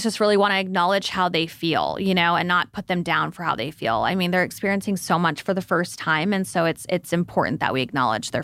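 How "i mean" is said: ordinary talk, nothing marked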